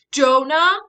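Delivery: loud high yell of his name; holler. Acted. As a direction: holler to stop him. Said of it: "I holler".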